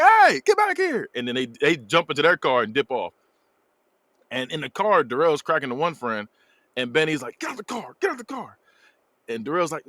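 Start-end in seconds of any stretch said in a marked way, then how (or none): 0.0-1.1 high, drawn-out call after a thief; mock yell. Catching a man stealing sunglasses, high mock yell. 7.4-8.6 high, hushed imitation of an order; mock demand. Benny ordering his friend out of the car, high soft mock demand.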